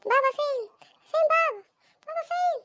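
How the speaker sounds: put-on high-pitched child's nagging calls, climbing; noisy kid demanding. Child asks mother where father is, noisily. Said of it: "بشويش يا ابني،".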